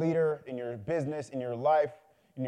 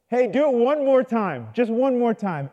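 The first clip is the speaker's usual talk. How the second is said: raised, loud call to someone distant; 6 semitones higher, 7 dB louder than usual. Acted. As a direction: call over, high and insistent. Farmer calls to his apprentice again.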